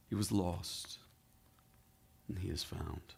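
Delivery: put-on father softly assuring the older son, tender hush; hushed tenderness. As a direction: let it fade into a loving hush. Father's tender closing words.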